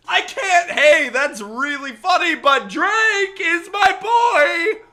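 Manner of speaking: loud, high shouting protest, dragged out slowly; outraged shout. Real, not acted. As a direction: yell it like a friend is being insulted. Defending [name], mock outrage.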